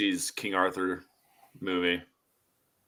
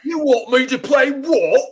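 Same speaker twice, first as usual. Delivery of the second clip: high, loud, shouted British "what?"; mock British accent; 12 dB louder than usual. The British actor mocked, outraged at the role offer, in a shouted, hammed-up British voice. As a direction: yell it as a baffled London lad.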